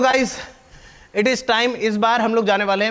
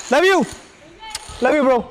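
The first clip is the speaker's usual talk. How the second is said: calling out; 6 semitones higher, 3 dB louder, much slower than usual. Real, not acted. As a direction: shout it out warmly from the balcony. Calling out to kids below.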